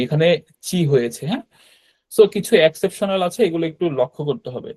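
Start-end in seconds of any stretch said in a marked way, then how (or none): 0.7-0.8 চি said as chi, not ti. Shown as a demonstration. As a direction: say চি with a ch sound, never ti. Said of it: "এখানে কিন্তু টি হয়নি".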